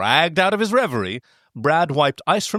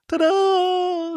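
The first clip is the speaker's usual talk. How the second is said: high, showy ta-da; triumphant. Acted. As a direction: announce it like a magic trick. Triumphant after pantsing Spock.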